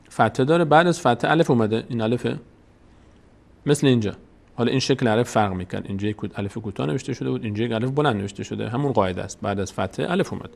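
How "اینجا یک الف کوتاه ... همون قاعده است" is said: usual speaking voice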